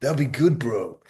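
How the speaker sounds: put-on teasing a mate with Loki's accent. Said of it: "I'll do this accent".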